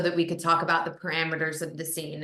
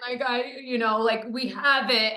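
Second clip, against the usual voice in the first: lightly mock-tearful plea, higher; half-hearted imitation of weeping producers; 6 semitones higher, 3 dB louder than usual. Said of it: "crying hysterically".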